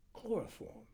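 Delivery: high, tentative single word; slow, thinking aloud. A narrator's reading. Doctor listing possible anesthetics, pensive and unhurried. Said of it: "He was speaking slowly and trying to think".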